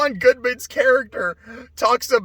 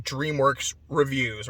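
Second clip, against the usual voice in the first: normal talking voice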